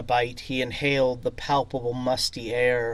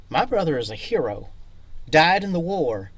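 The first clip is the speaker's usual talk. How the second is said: fast, louder heated words; angry hiss; 4 semitones higher, 7 dB louder, faster than usual. Acted. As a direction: spit it out fast in anger. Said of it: "the pianist hissed".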